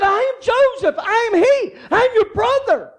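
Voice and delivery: high, loud, insistent I am Joseph; fervent declaration. Acted. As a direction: proclaim it like preaching at full force. Joseph insists to his brothers.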